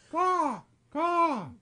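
high cawing crow call; bird caw. Pretend. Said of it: "I will make bird noises for you".

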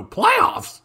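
high, squeaking Playoffs? in disbelief; incredulous Playoffs?. Retold or imitated. Fan in a crowd mocking playoff talk, high and incredulous. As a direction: yelp it like a stunned fan. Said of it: "somebody out of a crowd of five people go".

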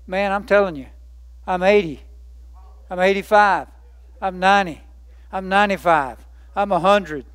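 normal talking voice

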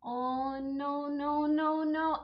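drawn-out string of no's, creeping up in pitch; cringing dismay. Retold or imitated. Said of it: "I feel so bad watching".

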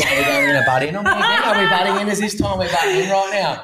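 high squealing mock scold, laughing through it; laughing mock outrage. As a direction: pretend to be offended at the interruption, cracking up. Mock scolding Chloe for interrupting.

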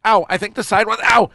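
high-pitched talk broken by sharp ows; real pain. Real, not acted. Man having his sides waxed, yelping in real pain. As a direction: yelp mid-sentence as it stings. Hurt by strips on his side.

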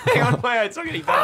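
laughing. Real, not acted. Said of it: "I'm gonna do a bird call".

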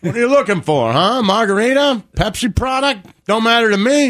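high-pitched, breezy waiter routine; chummy waiter patter. Acted. Waiter greeting a table of kids, hammed-up chummy.

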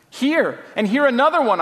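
high, quick wail of dismay. A narrator's reading. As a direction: wail it out in a hurry. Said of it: "I wailed".

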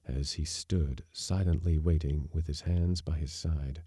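plain reading voice

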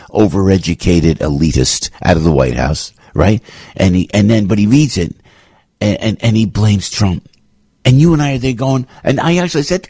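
normal talking voice

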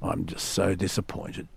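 low, soft, let-down sigh of a dad; hushed, heavy with disappointment; disappointed father. Retold or imitated. Dad gently telling his daughter off, low and soft. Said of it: "she said".

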